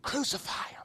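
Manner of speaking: a sly whisper from Satan. Acted. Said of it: "Satan thought this was his greatest victory".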